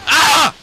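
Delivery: high, loud hammed-up dying yell; mock death scream. Mocking the soldiers' stupid death noises, loud mock scream. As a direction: ham up a game soldier's death cry. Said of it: "stupid noises".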